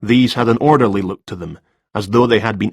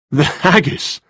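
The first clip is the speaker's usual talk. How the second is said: smile in voice; 6 semitones higher, slower than usual. Acted. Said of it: "couldn't disguise a smile in his voice".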